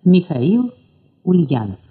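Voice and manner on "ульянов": ordinary delivery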